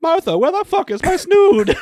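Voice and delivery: high, loud hammed-up demand; old-timey toff shout; mock furious aristocrat. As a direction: yell for the maid pompously. Period-drama parody continues.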